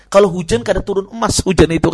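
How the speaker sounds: loud, emphatic retold rebuke; Umar's rebuke. Preacher quotes Umar to men idle in the mosque, loud rebuke. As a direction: proclaim it loudly, as a reported scolding. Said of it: "Kata Umar".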